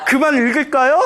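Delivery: hammed-up eagerness to stop; eager monk. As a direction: ask hopefully, playing it for laughs. Joking monks glad to stop chanting.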